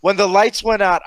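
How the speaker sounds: usual speaking voice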